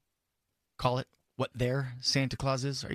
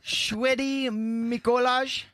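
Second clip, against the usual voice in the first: hammed-up quiz reading; 9 semitones higher, 4 dB louder, much slower than usual. Quiz options read out.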